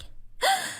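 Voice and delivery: sharp high gasp of surprise; loud gasp. Acted. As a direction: gasp in delight. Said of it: "شهقت بصوت عالي". (شهقة عالية من المفاجأة)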